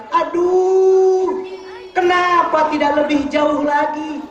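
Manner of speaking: drawn-out, high mock wail; imitated cry. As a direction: wail it slowly, like a dying man's regret. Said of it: "suamiku teriak".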